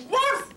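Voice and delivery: one loud, high woof; dog bark. Acted. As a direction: let out a single sharp bark. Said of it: "she said".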